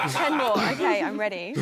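amused, laughing through the words. Real, not acted. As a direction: repeat it through real laughter. Amused by the promise of more.

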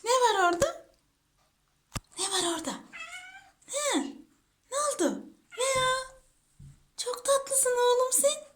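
high cooing voice. Real, not acted. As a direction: coo questions at a beloved pet. Cooing questions to the pet. (tiz, bebekle konuşur gibi)

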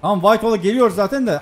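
ordinary talk, nothing marked

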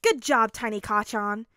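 loud mock praise; loud teasing praise. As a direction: cheer him on teasingly.

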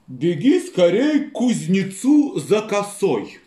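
very slow, lazy drawl; lazy master voice. Acted. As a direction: drag out every word like a lazy farmer.

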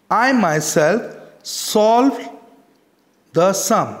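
slowly, weighting I and myself, pausing before the sum; emphatic teacher's example, leaning on "I myself"; stress on myself. Shown as a demonstration. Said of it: "we are putting an emphasis".

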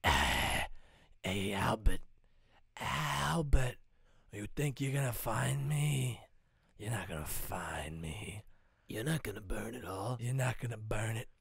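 low, creepy taunting villain with whispers; villain Slansky taunts Albert. Acted. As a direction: hiss threats at the hero. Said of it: "I was gonna talk like this the whole time".

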